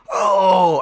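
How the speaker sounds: sudden loud realization. Retold or imitated. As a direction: blurt out Oh loudly. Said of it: "I think I probably said "Oh" out loud, really loud".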